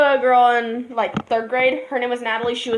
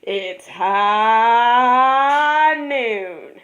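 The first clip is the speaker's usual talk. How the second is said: very slow, drawn-out cowboy line; McCree voice; much slower than usual. Acted. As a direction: draw the words out like a gunslinger.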